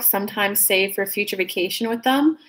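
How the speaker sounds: ordinary delivery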